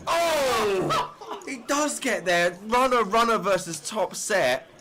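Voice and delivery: high, rising excited call. Real, not acted. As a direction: shout the river card's surprise. River card saves two players.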